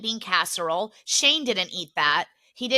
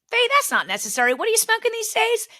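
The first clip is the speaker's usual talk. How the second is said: a fast, lightly voiced Stu, pitch jumping higher on the question; imitating Stu; 11 semitones higher, faster than usual. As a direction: rattle off Stu's reply quickly, rising sharply. Stu's reply voiced in story.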